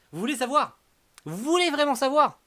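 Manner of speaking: loud, high teasing question; teasing build-up. Real, not acted. Teasing the viewers before withholding spoiler.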